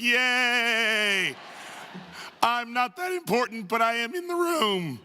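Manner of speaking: high, squealing delight at being snubbed, slowing on the last words; mock giddy joy. Comic telling the crowd how happy he was to be dismissed, high giddy squeal. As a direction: play it like a thrilled kid.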